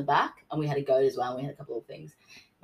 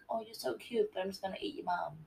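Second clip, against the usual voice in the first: put-on sweet baby talk; 3 semitones higher, 9 dB quieter than usual. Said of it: "be like".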